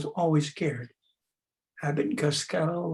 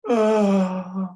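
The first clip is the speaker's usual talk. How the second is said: open-throated groan; 4 semitones higher, 6 dB louder than usual. Shown as a demonstration. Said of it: "then open-throated Oscar".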